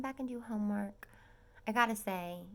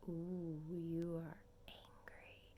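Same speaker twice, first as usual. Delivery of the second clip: slow soft catty whisper; imitating Renna; 3 semitones lower, 7 dB quieter, much slower than usual. Quoting Renna teasing Denise in a slow hushed voice. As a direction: drawl it out low and slow.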